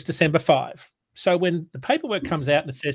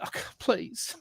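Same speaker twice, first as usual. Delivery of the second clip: pleading whisper; 4 semitones higher, 8 dB quieter than usual. Retold or imitated. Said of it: "Anyway, I'm almost crying".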